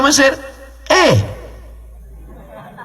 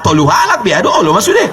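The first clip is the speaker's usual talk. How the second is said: mock shout; 5 semitones higher, faster than usual. Pilgrim's shocked reply retold.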